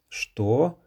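high, sharp "Что?"; shocked exclamation. A narrator's reading. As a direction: blurt it out in shock. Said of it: "в один голос воскликнули".

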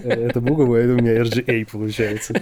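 fast words through laughter; laughing while talking. Real, not acted. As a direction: keep laughing as you explain.

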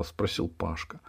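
plain reading voice

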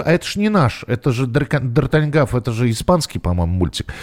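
ordinary talk

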